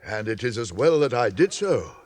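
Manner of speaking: usual speaking voice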